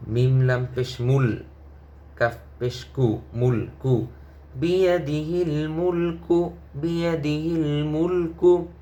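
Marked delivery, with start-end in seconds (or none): 4.6-8.7 higher chanted reading of বিয়াদিহিল মুলকু; melodic recitation. Shown as a demonstration. Teacher modelling the joined words for students, chanted. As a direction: recite it with rising tilawah melody. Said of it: "teacher models joined reading".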